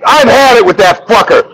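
high-pitched mock tirade; fed-up rant. Friend ranting about a late shipment, imitated angry fed-up rant. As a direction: act out a friend at the end of his rope.

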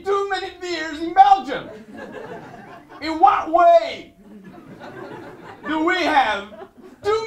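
loud, high shouted disbelief with laughs; mock outrage at the TV. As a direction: rant at the television in fake fury. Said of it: "I really shouted at my television".

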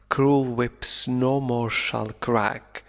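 ordinary delivery

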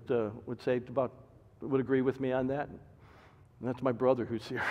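usual speaking voice

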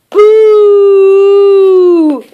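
loud boo from the fridge. Acted. Mister Greedy shouting boo from inside the fridge. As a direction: burst out with a boo. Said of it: "cried Mister Greedy".

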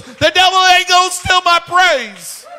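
very high, full-voiced triumphant shout; excited, pumped up. Old woman defying the devil, excited shout. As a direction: shout her defiance at the top of the range. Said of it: "She got all excited and all, all just all pumped up".

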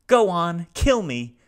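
high, loud, rasping despair; raspy robot. A narrator's reading. Grieving robot begs the family to kill him, raspy and high. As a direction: rasp it out, begging to die. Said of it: "said Salo raspingly".

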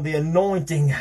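"anointing" leaned on with a trailing "ah"; preacher-style stress on "anointing"; mock extra emphasis. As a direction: imitate a preacher stretching "anointing". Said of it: "put that extra emphasis on".

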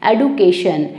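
एडुकेशन with a plain D, ed-oo-KAY-shun; American pronunciation. Shown as a demonstration. Teacher demonstrating the American education with a clear d. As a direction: keep the d in एडुकेशन, American style. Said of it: "डी को डी ही बोला जा रहा है".

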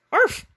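sharp high loud arf; dog bark. A narrator's reading. Pluto under the sheet barks at the friends, high loud bark. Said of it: "barked Pluto".

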